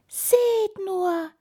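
high, loud delighted squeak; tiny voice full of delight; beaming mouse. A narrator's reading. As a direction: call friends over as a thrilled little mouse. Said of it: "strahlte die Maus".

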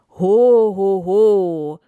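loud, ringing Santa laugh; booming ho ho ho. Acted. Santa starting the sleigh, loud booming ho ho ho. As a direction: let it boom and echo. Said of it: "einem laut hallenden".

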